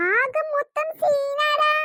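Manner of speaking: very slow, stretched-out words; stretched, slow admission of a mess; drawn-out confession. Acted. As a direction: draw out every word as you admit the trouble. Confessing an embarrassing predicament to friend.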